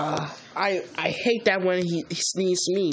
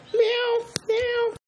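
very high squeaky meows; cat meowing; much slower than usual. Pretend. Pretend kitten meowing during play, very high. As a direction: meow like a tiny kitten.

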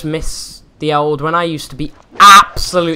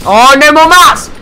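loud alarmed cry; panicked shout; 10 semitones higher, 9 dB louder than usual. Real, not acted. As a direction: yell it in panic. Out of materials mid-fight.

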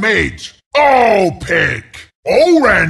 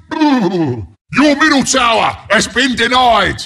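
ordinary talk

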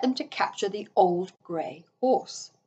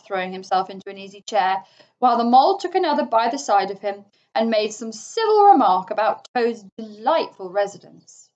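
ordinary delivery

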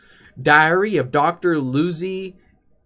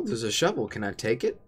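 ordinary talk, nothing marked

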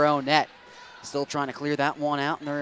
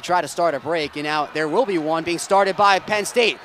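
breakaway goal call; 8 semitones higher, 12 dB louder than usual. Real, not acted. Play-by-play caller on a breakaway goal, building to a roar. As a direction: let it swell to a roaring shout on the goal.

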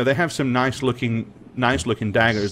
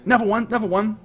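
dockworker voice; 10 semitones higher, much faster than usual. Acted. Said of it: "He goes".

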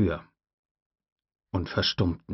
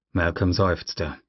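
usual speaking voice

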